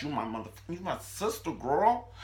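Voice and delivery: put-on high squealing sister, girl. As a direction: gush it way up high. Gushing to friend on screen.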